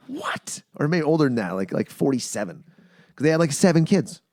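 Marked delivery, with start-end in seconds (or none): 0.1-0.6 much higher, softer stunned question; shocked what. Retold or imitated. Hearing the mother's age, a high stunned what. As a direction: gasp it in disbelief. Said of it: "I'm like".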